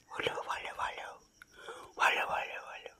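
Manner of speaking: soft whispered call of the dog's name; whispering. Real, not acted. Calling the dog quietly while father sleeps, whispering. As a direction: whisper so nobody wakes. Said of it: "আস্তে আস্তে কথা বলছি".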